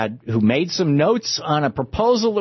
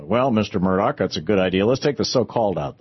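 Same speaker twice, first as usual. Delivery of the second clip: lightly voiced low flunky; understated yes-man, pitched down; advisor humoring the boss; 6 semitones lower than usual. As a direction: answer the tycoon in a low, deferential voice. Advisor answering acted Murdoch.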